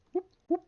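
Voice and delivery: high, loud siren-like whoop; playful whoops. Pretend. Gamer cheering over new loot, high playful whoops.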